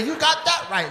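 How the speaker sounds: high, pointed agreement; mock angry woman.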